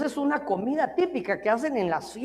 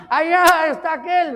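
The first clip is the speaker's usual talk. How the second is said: sheep shouting a warning; 6 semitones higher, 8 dB louder than usual. Acted. Sheep points out the wolf.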